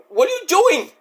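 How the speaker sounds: high-pitched mock crying protest; mock tearful. Upset son to his mother's tormentor, mock tearful. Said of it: "No, he's crying".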